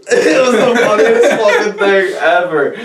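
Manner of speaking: can barely get it out for laughing. Real, not acted. Laughing recalling the moment.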